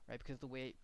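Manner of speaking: usual speaking voice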